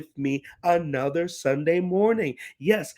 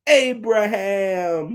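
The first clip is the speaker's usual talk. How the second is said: Abraham drawn out slowly, a little louder; slow reveal; 3 semitones higher, 4 dB louder, much slower than usual. Shown as a demonstration. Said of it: "His name is".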